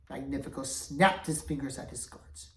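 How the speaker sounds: ordinary delivery, nothing marked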